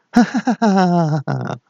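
high staged villain cackle; mocking laugh. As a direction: laugh at him in a high, jeering run. Villains laugh at their joke.

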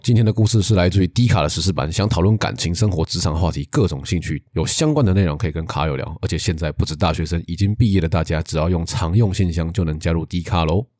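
brisk sponsor read. Shown as a demonstration. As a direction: rattle off the ad copy. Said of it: "一口气直接把那口播稿念完". (念口播稿的语气)

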